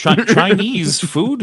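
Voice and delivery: high, slow, dopey voice; mock clueless voice. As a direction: play it dim and puzzled. Mock Disney voice continues.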